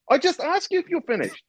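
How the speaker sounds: very high, exasperated mock outburst; squeaky-high retold indignation; angrier staff. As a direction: imitate an instructor at the end of his patience. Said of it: "staff's getting angry and angrier".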